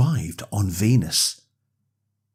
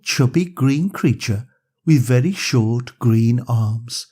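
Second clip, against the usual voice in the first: usual speaking voice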